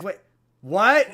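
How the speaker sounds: sharp loud "what?" rising in disbelief; mock outrage, shouted question; baffled yell of disbelief; incredulous. Reacting to the arbitrary rule.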